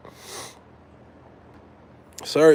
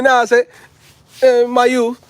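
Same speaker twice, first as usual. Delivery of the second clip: high, loud Jamaican patois; mock Jamaican accent; 8 semitones higher, 5 dB louder than usual. Vlogger posing as the next [name], mock Jamaican accent. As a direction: put on a playful Jamaican accent. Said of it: "[name]'s the next [name]".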